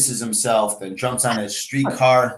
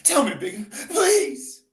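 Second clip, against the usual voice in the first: high, crying plea; tearful plea. Acted. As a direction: beg him through tears. Bessie begging frightened Bigger.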